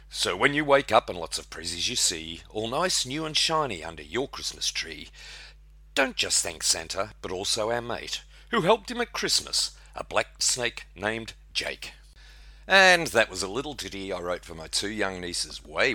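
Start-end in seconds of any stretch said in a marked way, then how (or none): 0.1-11.9 lively rhyming read-aloud; poem recitation. Acted. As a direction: recite it like a Christmas ballad.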